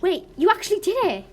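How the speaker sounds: high, loud exclamation of disbelief; shocked. Acted. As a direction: blurt it out, astonished. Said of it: "in shock he said".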